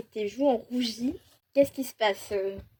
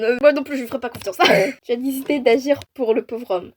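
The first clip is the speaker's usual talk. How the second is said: usual speaking voice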